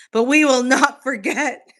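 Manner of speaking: loud, laughing rallying cry; mock defiant vow. Reading a chat conspiracy aloud, mock defiant vow. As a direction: declare it like a rebel, cracking up.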